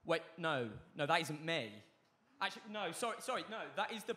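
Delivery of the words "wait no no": ordinary talk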